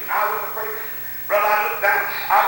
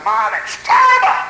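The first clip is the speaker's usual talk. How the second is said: high, loud, drawn-out outcry; shocked exclamation; 5 dB louder, slower than usual. Retold or imitated. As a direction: cry it out in mock horror. Acted shocked reaction to the literature.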